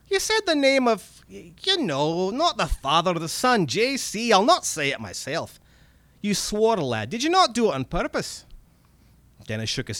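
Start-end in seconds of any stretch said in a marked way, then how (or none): 0.1-8.4 high, excited Scottish goblin; wheedling little red cap creature voice. Acted. Goblin speaks in story.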